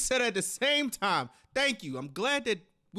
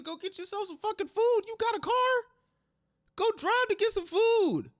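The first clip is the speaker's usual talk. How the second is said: higher and louder, fed-up shouting; exasperated yell; 10 semitones higher, 4 dB louder than usual. Real, not acted. Cohost yelling at the girlfriend to go get food. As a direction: bark it at her like she's in the room.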